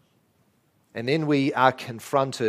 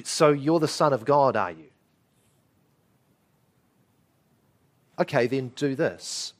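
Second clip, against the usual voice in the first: casual taken-for-granted. Acted. Devil casually prodding Jesus to act, offhand. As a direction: say it as an accepted fact, unimpressed. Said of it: "The devil is saying".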